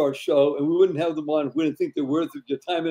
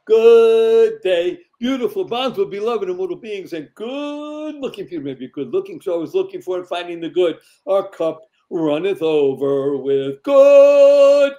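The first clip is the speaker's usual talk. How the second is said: show host introduction; 3 semitones higher, 4 dB louder than usual. Acted. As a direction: herald the guest like a showman. Host delivers guest introduction.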